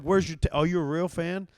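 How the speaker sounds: mock hostile fan challenge. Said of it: "He's like".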